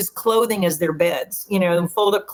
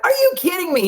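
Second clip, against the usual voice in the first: much higher, stunned disbelief; retold flip-out; 10 semitones higher than usual. Son learning he played with the band, high surprised disbelief. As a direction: imitate the son flipping out with surprise. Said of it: "flipped out".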